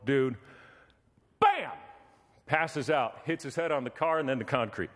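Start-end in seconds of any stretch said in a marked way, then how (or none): 1.4-1.7 sharp vocal bam for a fall; impact sound effect. Retold or imitated. Preacher mimicking the man hitting the ground, sharp high bam.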